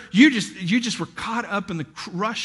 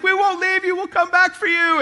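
much higher, slightly louder hammed-up reassurance; mock heroic promise; 10 semitones higher, 4 dB louder than usual. Husband answering his wife like in a war zone, mock heroic. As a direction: play a soldier promising a rescue.

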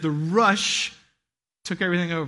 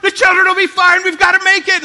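much higher, louder hurried insistence; frantic insisting; 10 semitones higher, 10 dB louder than usual. Retold or imitated. Husband answering his wife while rushing out, loud and high. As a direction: push through, no time to argue.

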